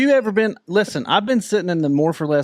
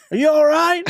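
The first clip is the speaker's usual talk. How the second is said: high-pitched holler up into the woods; imitated distant yell; 11 semitones higher than usual. Driving range guy yelling up to crashed rider, imitated holler. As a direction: call out to someone far off, acting it out. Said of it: "he just yells up in the woods".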